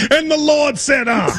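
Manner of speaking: mock preacher opening a sermon. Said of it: "That's what you sound like".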